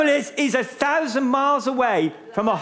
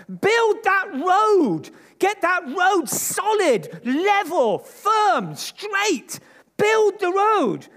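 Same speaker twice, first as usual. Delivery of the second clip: fiery preaching; 8 semitones higher, slower than usual. Acted. Preacher urging the congregation to build, fiery preaching. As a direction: thunder it out to the church.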